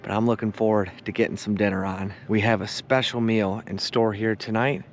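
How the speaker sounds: low, calm, relaxed narration; calm evening narration. Acted. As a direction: wind down the day gently.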